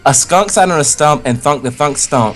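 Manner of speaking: ordinary talk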